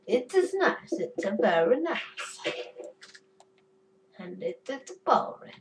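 high, punchy mock-Kazakh accent like Borat; Borat impression. Gamer showing off an impression, Borat accent. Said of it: "My board impression is pretty good".

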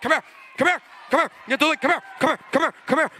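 rapid-fire imitation of someone summoning colleagues; urgent calling over. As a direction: repeat it urgently, as if hurrying people over.

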